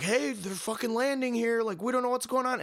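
high, rushed panic from a soldier under attack; panicked video soldier. Retold or imitated. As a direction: pitch up and blurt it like the sky is falling.

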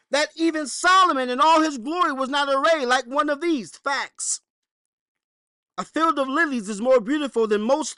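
loud, high preacher's scripture reading; preaching scripture. Acted. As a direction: proclaim it from the pulpit. Reads scripture at full preaching force.

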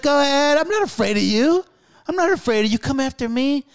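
imitating a cocky elder daring a rival; defiant taunting elder. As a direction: taunt him, unafraid. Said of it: "He's like".

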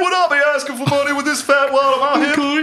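high strained cartoon impression, loud; Mister Mackey voice. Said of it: "he's Mister Mackey from South Park now".

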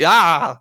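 loud, high mock scream; startled yell. Man reacting in mock alarm to a voice, startled yell. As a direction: yelp as if alarmed.

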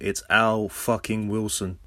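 ordinary talk

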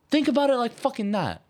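high-pitched, insistent telling-off; exasperated retold scolding. Retelling own scolding of Dennis.

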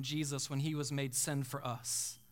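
low, soft, sinking delivery; somber hush. Real, not acted. Somber account of Jesus forsaken.

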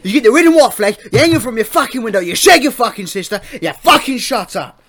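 loud, high, hammed-up English lout accent; proper English accent. Co-host lecturing "son" on the flag, hammed-up English accent. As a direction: rant like a mock flag-waving English bloke. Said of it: "talk a fucking proper English accent".

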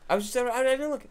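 mock innocence. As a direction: play the caught cheater protesting. Pretend innocence after cheating at book.

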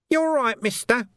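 high, sly, wheedling urchin voice; high-pitched cockney urchin hustling a copper; cheeky street urchin. Acted. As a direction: haggle like a grubby street kid. Urchin Nobby's voice.

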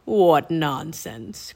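low, slow, scornful croak; croaking Raven King. A narrator's reading. Raven King dismisses Annie's plea, slow croak. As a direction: croak it slowly with disdain. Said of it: "croaked the Raven King".

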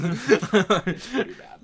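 high, slow, laughing through it. Real, not acted. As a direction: laugh while saying it. Laughing reply.

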